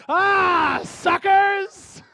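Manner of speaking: loud triumphant yell; gleeful shout. Real, not acted. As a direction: shout it out, gloating. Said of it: "Sorry, inside voice".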